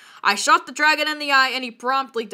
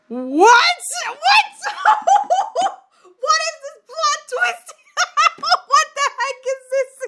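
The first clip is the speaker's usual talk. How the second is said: high squeals through real laughter; shrieking, helpless giggles; laughing disbelief; 14 semitones higher, 4 dB louder than usual. Real, not acted. Reader cracks up at plot twist.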